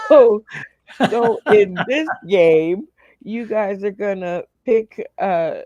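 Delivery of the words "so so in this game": loud, pushing on through laughter; laughing restart. Real, not acted. Host explains the game to the contestants, laughing. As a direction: get back to the rules while still laughing.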